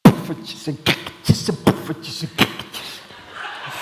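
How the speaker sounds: slow, breathy beatboxed poffertjes and crackertjes; beatbox rhythm. Shown as a demonstration. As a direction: turn the words into a beatbox beat. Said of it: "put some emphasis on the words".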